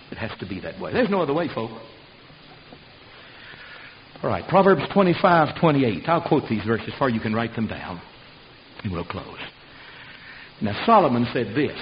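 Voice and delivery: ordinary talk, nothing marked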